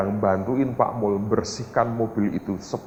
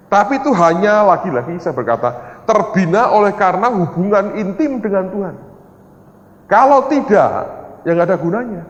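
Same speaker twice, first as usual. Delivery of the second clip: loud, raised preaching voice; fervent preaching; 10 semitones higher, 7 dB louder than usual. Acted. Pastor telling the congregation all is God's, loud and fervent. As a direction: drive the point home to the congregation.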